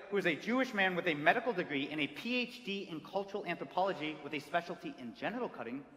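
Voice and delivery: ordinary delivery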